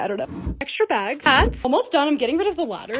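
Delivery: ordinary talk, nothing marked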